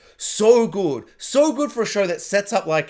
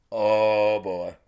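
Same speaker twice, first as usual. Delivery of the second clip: very low, trailing-off murmur; stunned reaction; 8 semitones lower than usual. Retold or imitated. Viewer watching her walk past, low stunned murmur. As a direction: drop right down low and let it fade.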